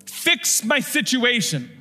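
high, slow mock prayer; imitated plea. Preacher imitating how we pray to Jesus, acted pleading. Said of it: "That's how we pray".

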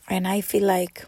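ordinary talk

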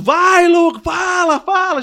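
high, loud impatient urging; imitated high-pitched shout; crowd shouting. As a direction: yell like an anxious crowd egging him on. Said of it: "As pessoas gritando".

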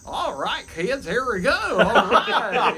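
high, hammed-up Southern twang; exaggerated Southern children's host; Southern accent. Shown as a demonstration. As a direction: call to the kids in a broad Southern accent.

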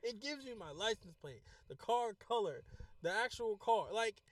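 high, loud, hammering each item; exasperated rant. Real, not acted. Driver venting about passengers ignoring the app, exasperated. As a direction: list it off in loud disbelief.

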